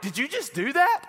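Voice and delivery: high-pitched incredulous question; acted-out shock at being hit; stunned disbelief. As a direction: retell it in astonished high voice. Stunned reaction after being slapped.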